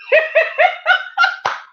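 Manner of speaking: high-pitched laugh; derisive laugh. Real, not acted. Laughing at own mock plea.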